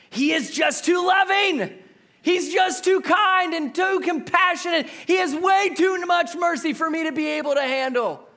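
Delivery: loud, high hammed-up whining; mock-outraged yell that God is too kind; mock complaint shout. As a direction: shout the absurd grievance. Mock complaint about God's love.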